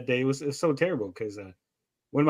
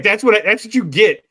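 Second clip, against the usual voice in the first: put-on louder, indignant child payback; defiant kid; 4 semitones higher, 7 dB louder than usual. Child explaining to mom why she threw the shoes, defiant. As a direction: throw it back like a spiteful little kid. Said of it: "She's like".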